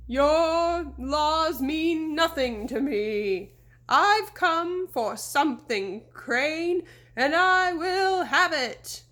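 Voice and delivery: slow, loud ghostly bellow. A narrator's reading. Fake horseman threatening the deputy, slow loud bellow. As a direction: boom the threat like a phantom. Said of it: "Sabrina bellowed".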